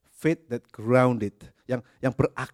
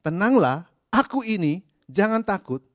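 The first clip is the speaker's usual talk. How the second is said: emphatic; 6 semitones higher, 6 dB louder than usual. Acted. Jesus' words restated with force.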